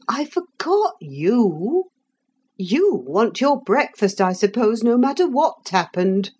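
a hint of a weary nurse. A narrator's reading. Nurse voiced in story.

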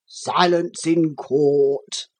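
very slow, drawn-out judge's command; long, stretched courtroom order; judge calling for order. A narrator's reading. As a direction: intone it like a stately judge. Judge orders silence in story.

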